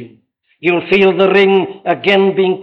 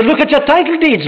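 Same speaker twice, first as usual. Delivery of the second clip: preacher's reply; 9 semitones higher than usual. Retold or imitated. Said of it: "I say".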